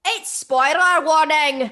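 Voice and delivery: slow, loud announcer voice. Pretend. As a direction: boom it out like a trailer voice. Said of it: "said warning".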